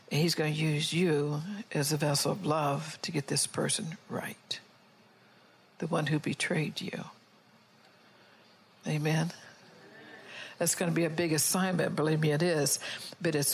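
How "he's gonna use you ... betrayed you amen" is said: hushed, secretive whisper to the congregation; confiding whisper from the pulpit; whispered aside. Real, not acted. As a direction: lean in and whisper it. Preacher drops to an aside.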